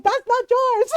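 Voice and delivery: high, loud retold yell. Mom yelling at a purse snatcher, angry. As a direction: imitate an irate mom defending her things. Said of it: "And she's yelling at him".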